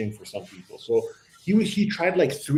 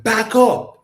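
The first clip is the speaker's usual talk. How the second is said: loud, higher snap with no patience; impatient command; 8 semitones higher, 6 dB louder than usual. Retold or imitated. Driver repeating the order, louder and impatient. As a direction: order him back, fed up. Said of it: "with, like, no patience".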